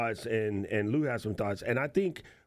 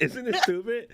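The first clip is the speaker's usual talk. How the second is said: laughing; 11 semitones higher, 3 dB louder than usual. Real, not acted. Laughing at show intro.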